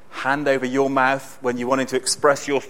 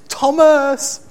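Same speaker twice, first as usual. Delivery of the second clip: put-on an overjoyed little boy calling out, higher and louder; excited child's cry; 13 semitones higher, 7 dB louder than usual. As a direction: cry the name out in a child's delight. Toddler jumping off the ground.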